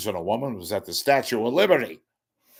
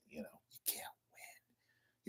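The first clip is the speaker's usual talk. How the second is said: slow, resigned whisper; 5 semitones lower, 28 dB quieter than usual. Real, not acted. Host whispering his resignation to the viewers. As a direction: breathe it out, defeated.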